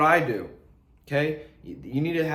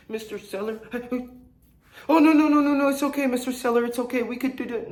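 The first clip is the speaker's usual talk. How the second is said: needy caller; 8 semitones higher, faster than usual. Retold or imitated. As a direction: cave in to the seller in a panic. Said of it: "Stop being needy".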